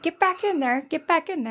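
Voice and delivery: high-pitched cartoon cop impression; cop holding back tears. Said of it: "the cop is starting to cry, and he's like".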